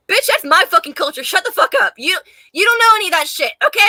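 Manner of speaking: fast, heated mock rant at a Northern poser; mock angry rant. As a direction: scold a Yankee poser, half joking. Imagined rant at Yankee poser.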